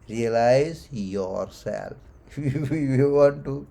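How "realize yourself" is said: "realize yourself" very slow, each word set apart; underlined words. Shown as a demonstration. Teacher repeating the key word, "realize yourself" slow and weighted. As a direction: draw out "realize", then land deliberately on "yourself". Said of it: "Underline the word".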